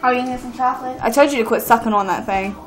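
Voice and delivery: normal talking voice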